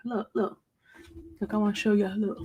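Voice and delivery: whispered look look; soft unvoiced whisper. Real, not acted. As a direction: hush it to the viewers. Showing gray roots on live.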